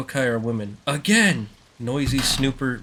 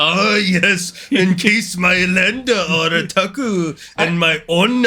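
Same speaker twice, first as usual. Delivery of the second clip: put-on Japanese accent, higher and slow; 6 semitones higher, 5 dB louder, slower than usual.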